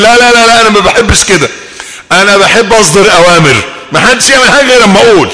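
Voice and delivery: bossy commander. Pretend. Bossy man giving orders.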